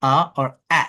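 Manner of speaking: two আ sounds contrasted in isolation; vowel demo. Shown as a demonstration. Teacher demonstrating the vowel sound for the class. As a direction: model both আ sounds alone. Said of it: "আই না হয়ে আ বা আ হয়".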